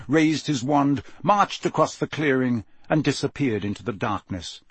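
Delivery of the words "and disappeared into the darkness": ordinary delivery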